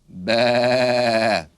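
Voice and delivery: loud, long bleating baa; lamb bleat. Shown as a demonstration. Teacher showing children what a lamb says, loud bleat. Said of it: "And a lamb goes".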